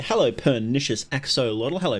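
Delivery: ordinary talk, nothing marked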